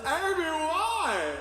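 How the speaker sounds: very high, slow, laughing wail; high drawn-out mock heartbroken whine; mock wailing boyfriend. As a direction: plead with his ex, stretched out and high. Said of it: "He's like".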